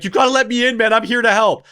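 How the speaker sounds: mock-urgent appeal to be let in; urgent pleading. Brother calling to a crying child behind a closed door, urgent pleading.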